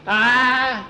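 high acted cry of alarm; high yelp. A narrator's reading. Skeeter grabbed for a kiss, high yelp.

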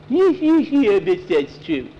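high, very slow taunting whine; mocking mimicry. A narrator's reading. Boy mocks Nancy's doubting remark, taunting mimicry. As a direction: mimic it back in a silly high voice.

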